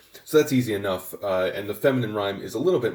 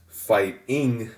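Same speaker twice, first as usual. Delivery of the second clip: "fighting" broken slowly as fight-ING; forced final stress; 2 semitones higher, 4 dB louder than usual. Shown as a demonstration. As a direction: push the weight wrongly onto the -ing of "fighting". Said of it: "you'd be forcing the stress to be on the last syllable".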